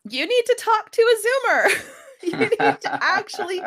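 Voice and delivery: laughing insistence. Real, not acted. Laughing emphatic advice.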